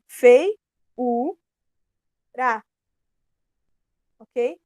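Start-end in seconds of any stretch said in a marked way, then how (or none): 0.1-2.6 "Fei, u, ra" split into syllables with clear pauses; "Fei... u... ra", each syllable standing alone. Shown as a demonstration. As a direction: break it up slowly, "Fei" loud, then "u", then "ra". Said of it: "separar as sílabas pra ficar mais fácil".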